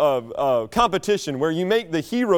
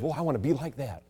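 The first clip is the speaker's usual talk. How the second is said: softer, lower wistful mock voice; envious believer; 4 semitones lower, 9 dB quieter than usual. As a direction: sigh it like a jealous churchgoer. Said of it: "instead of".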